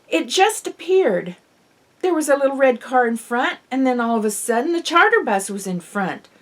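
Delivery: urgent, almost pleading insistence that it appeared; a girl insisting no one believes her; insistent disbelief. A narrator's reading. Narrator insists on what she saw.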